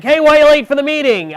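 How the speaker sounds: high, loud boss voice calling across the office; a loud, high-pitched boss demanding an answer; boss asking. Retold or imitated. Boss demanding why he's late, high and loud.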